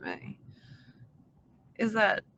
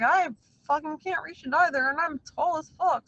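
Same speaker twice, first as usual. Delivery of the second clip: higher and louder, fed-up griping; exasperated complaint; 7 semitones higher, 4 dB louder than usual. Retold or imitated. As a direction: gripe like you can't believe it either. Retelling own complaint about reaching.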